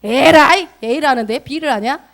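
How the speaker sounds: loud, high, exasperated scolding; hammed-up booming rebuke; big mock yell. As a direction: shout it like an impatient boss correcting someone. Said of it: "이게 크게".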